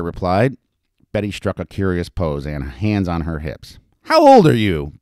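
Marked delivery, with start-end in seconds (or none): 4.0-4.9 loud, high demand; insistent question. A narrator's reading. Madam questioning a youthful stranger, insistently. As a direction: press hard for an answer. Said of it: "Betty insisted".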